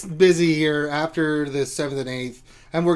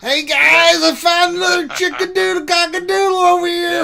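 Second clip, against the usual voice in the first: high, loud, silly character voice; comic high-pitched holler; hollered goofy announcement; 7 dB louder than usual. Acted. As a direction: call it out shrieky and held high, like an excited goofball. Jokey answer about chasing a chicken.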